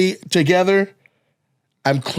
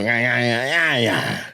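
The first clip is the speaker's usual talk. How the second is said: each yeah in the same falling beat, a touch lower; rolling same-rhythm yeahs, lightly; Rico's cadence; 4 semitones lower, 3 dB quieter, slower than usual. Retold or imitated. Comic imitating Rico's repeating cadence for a friend. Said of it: "had the cadence of".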